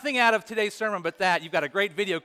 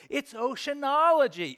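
mock yelling; 6 semitones higher than usual. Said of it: "the brother's yelling back and forth".

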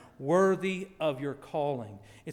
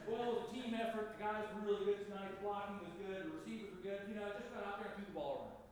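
much softer, quick false-modest athlete; Peyton Manning impression; 12 dB quieter, much faster than usual. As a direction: brush off praise in fake humility. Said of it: "false humility like Peyton Manning has".

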